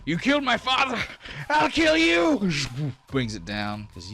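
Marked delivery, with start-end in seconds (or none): 0.0-2.5 screaming in rage, high and loud; shouting. Acted. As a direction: scream it at the killer of your father.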